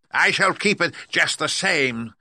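high, loud, slow gloating; mock her in a drawn-out witch voice; Wicked Witch. A narrator's reading. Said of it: "laughing at her".

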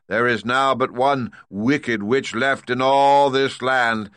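loud, high, slow commanding giant-head voice; booming great head. Acted. Great head demanding payment from Dorothy, loud and stern. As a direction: lay down the law from the throne.

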